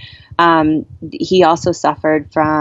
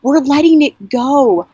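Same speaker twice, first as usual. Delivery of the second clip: screaming to dad; 8 semitones higher than usual. Retold or imitated. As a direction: shout the release up to him. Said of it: "I just started screaming to him".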